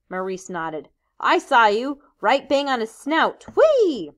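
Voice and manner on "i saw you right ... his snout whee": loud, high and gleeful; excited cheer. Acted. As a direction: cheer him on. Maurice cheers Ralph's hit.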